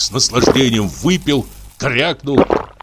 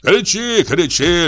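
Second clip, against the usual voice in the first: mocking jeer; 8 semitones higher, slower than usual. Парикмахер издевается.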